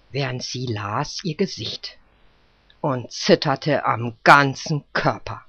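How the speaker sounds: ordinary delivery, nothing marked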